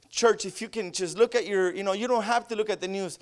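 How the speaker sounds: ordinary talk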